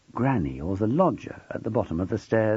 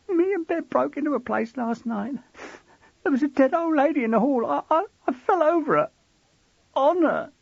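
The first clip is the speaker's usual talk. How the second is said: put-on higher, faster, tumbling panicky boy; trembling boy. A narrator's reading. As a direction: blurt it out in a frightened rush. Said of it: "trembling, crying".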